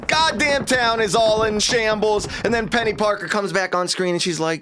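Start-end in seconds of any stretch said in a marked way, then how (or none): none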